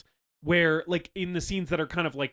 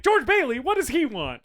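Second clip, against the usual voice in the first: much higher, louder indignant mother; suspicious mom; 11 semitones higher, 6 dB louder than usual. Retold or imitated. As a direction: demand to know what he wants. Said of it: "And she's like".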